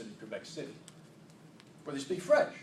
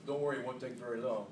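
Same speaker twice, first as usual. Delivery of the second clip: ordinary talk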